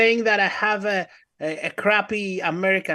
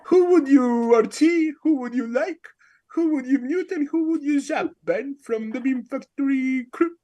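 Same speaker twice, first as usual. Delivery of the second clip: higher, put-on British quiz questions; mock British accent; 5 semitones higher than usual. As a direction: fake a British accent as a non-native speaker. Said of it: "do a fucking British ass accent".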